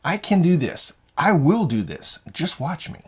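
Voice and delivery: emphatic, self-assured vow; confident self-talk. Acted. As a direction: declare it with conviction. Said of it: "Confidence is doing".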